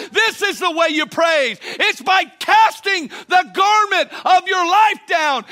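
loud, high preacher's cry to the church; full-force preaching. Acted. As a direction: preach it out, lifting the crowd.

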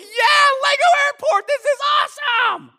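put-on kid's elated cheer. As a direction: cheer like a child unwrapping a dream toy. Acting out himself thrilled as child.

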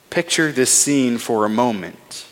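usual speaking voice

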